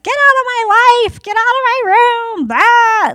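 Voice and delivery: high, loud mock yell of an upset kid; imitating yelling. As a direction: scream it like a kid slamming the door. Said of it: "Just yelling".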